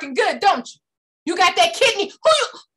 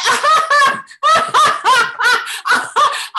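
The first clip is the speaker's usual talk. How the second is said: loud high laugh at her; big mocking laugh; 10 semitones higher, 6 dB louder than usual. God laughing at her complaint, loud and high.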